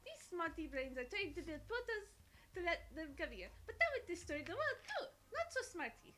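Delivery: put-on playful French-accented storyteller; horrible French accent. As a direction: tell it with a hammed-up French accent. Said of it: "Excuse my horrible French accent".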